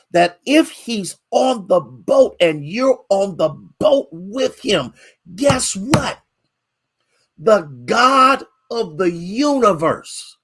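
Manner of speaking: high, slow, ringing preacher cadence; preaching at full force. Acted. Pastor preaching to his congregation, fervent high preaching. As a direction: stretch every phrase out to the pews.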